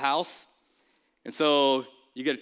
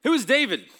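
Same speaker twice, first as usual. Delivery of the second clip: loud, higher, dismissive challenge; scornful question; 7 semitones higher, 5 dB louder than usual. Acted. Nabal mocking David's messengers, loud scornful question. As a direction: sneer out the question. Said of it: "Nabal asks them".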